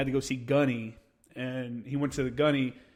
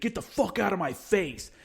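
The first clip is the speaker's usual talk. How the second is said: higher-pitched imitated threat, held back in volume; angry warning; 6 semitones higher than usual. Cornered kid with screwdriver to marine, angry warning. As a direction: act out warning someone off. Acted quarrel, screwdriver pointed.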